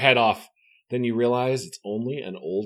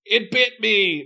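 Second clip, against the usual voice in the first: panicked cry; 9 semitones higher, 3 dB louder, slower than usual. Acted. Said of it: "Mark cries".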